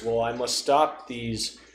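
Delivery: usual speaking voice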